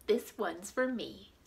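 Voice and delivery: ordinary delivery, nothing marked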